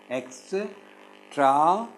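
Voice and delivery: very slow "extravaganza" with long gaps between syllables; "extravaganza" in five isolated, drawn-out pieces. Shown as a demonstration. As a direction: break "extravaganza" into ex, tra, va, gan, za. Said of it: "five syllables".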